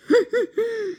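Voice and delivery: very high-pitched cackle; high giggle. Pretend. Escaping character after getting away, high giggle.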